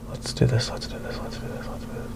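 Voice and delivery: whispered pep talk fading away; fading mutter. Real, not acted. Teacher quietly psyches himself up to start, whispering. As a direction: psych yourself up under your breath until it's gone. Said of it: "psyching himself up".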